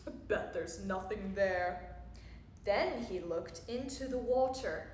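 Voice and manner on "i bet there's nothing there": low, dismissive dad voice; lower-pitched storybook disdain; scoffing. A narrator's reading. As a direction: scoff after a short huff. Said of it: "and scoffed".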